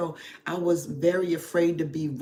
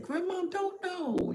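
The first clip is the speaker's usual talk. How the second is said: tender to grandson; 13 semitones higher than usual. Retold or imitated. Answering her grandson's question.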